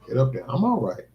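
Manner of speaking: ordinary delivery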